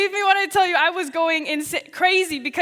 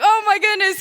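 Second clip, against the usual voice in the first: amazed exclamation; 6 semitones higher, 7 dB louder than usual. Retold or imitated. Passport arrives same night.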